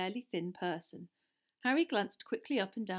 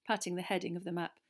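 plain reading voice

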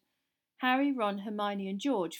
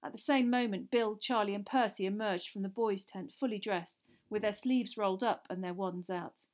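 usual speaking voice

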